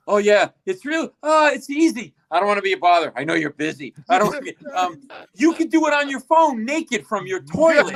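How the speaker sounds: mock your own flustered, over-eager sales pitch; panicked babbling. Re-enacts his panicked pitch to [name].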